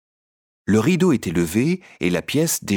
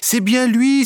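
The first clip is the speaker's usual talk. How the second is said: high, louder cry on lui; 6 dB louder, slower than usual. A narrator's reading. Columbine cries out to the puppets, high and excited. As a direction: call it out, thrilled. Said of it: "s'écria madame Colombine".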